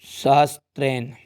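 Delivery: slow, drawn-out syllables; slow dictation. Real, not acted. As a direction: dictate it slowly as if writing it. Dictating a word while writing it. (धीमे, लिखवाने के अंदाज़ में)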